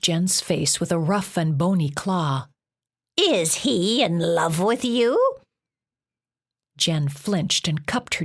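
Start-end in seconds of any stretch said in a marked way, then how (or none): 3.2-5.4 slow, stern, scratchy monster voice. A narrator's reading. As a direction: press her coldly like a scratchy-voiced beast. Monster after slapping Jen.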